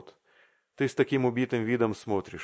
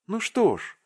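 anxious expectation; 12 semitones higher, 3 dB louder than usual. A narrator's reading. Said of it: "даже побледнев от ожидания".

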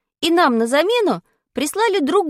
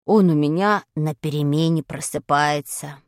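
put-on much lower dull boy's voice; sluggish boy; 10 semitones lower than usual. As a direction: answer dully, like a sleepy lad.

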